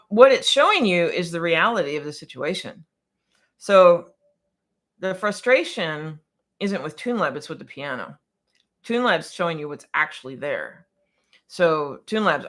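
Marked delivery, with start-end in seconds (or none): none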